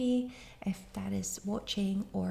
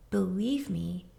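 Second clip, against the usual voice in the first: ordinary talk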